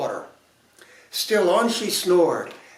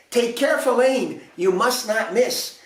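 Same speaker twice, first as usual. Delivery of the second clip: higher, urgent fairy voice; insistent fairies; 7 semitones higher than usual. A narrator's reading. Fairies to the prince, high insistent fairy voice. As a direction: drill it into him.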